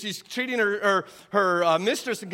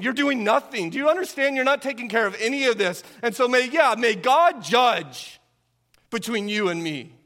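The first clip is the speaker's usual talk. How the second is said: raised, heated reproach; indignant wife; 5 semitones higher than usual. Acted. Acted wife scolding passive husband.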